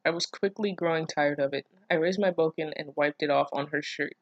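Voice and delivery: ordinary talk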